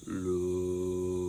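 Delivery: deepest, lowest sung note; extremely deep bass voice. Shown as a demonstration. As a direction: sing it as low as you possibly can. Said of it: "you're gonna sing very low".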